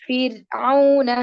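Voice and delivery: slow loud ഫിർഔന, light ra. Shown as a demonstration. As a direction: model ഫിർഔന with a thin ra. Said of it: "ഇതാണ് ഇതിന്റെ examples".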